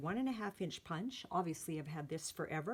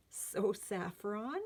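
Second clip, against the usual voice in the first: normal talking voice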